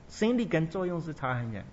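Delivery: usual speaking voice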